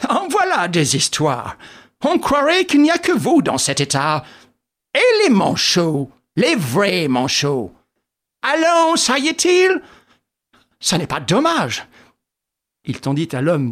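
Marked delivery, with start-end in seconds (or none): 0.1-11.9 cheerily brushing off complaints; joyful exclaiming. A narrator's reading. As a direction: tease a friend with lively swoops. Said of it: "s'exclama joyeusement Sholmès".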